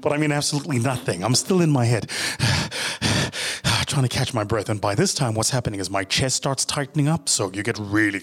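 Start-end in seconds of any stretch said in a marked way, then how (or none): 2.1-3.8 a long breathy exhale in panic; breathless sigh. Acted. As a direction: breathe out hard as if chest is tight.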